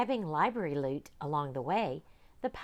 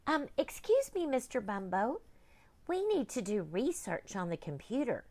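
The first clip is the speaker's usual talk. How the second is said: put-on high polite girl; high child's voice; young girl; 7 semitones higher than usual. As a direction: ask sweetly like a schoolgirl.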